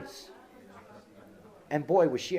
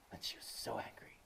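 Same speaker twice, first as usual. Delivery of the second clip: usual speaking voice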